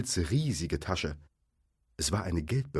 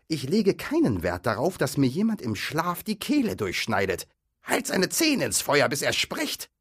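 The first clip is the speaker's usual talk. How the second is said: higher troll voice, growing louder; threatening troll; 8 semitones higher, 4 dB louder than usual. A narrator's reading. Bert demanding the captive be made to talk, threatening. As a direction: build to a harsh order.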